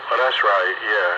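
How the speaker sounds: ordinary delivery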